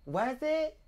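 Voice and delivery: high, innocent surprised question; retelling her own surprised reply; mock surprise. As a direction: play it like it's news to you. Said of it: "And I was like this".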